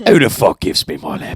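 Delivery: put-on British hard-man accent; indignant tough Brit accent; Jason Statham. Riffing on a stolen laptop, British tough-guy accent. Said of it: "Now he's Jason Statham".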